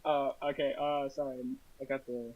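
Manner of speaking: faint and far from the mic; talking from across the room; in the other room. Real, not acted. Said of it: "You sound like you're in the other room".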